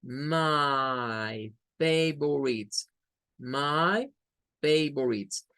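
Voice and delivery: "My favorites" drawn out very slowly, each sound apart. Shown as a demonstration. Teacher slowly modelling the phrase for beginners. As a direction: stretch "My favorites" out word by word. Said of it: "y decimos my".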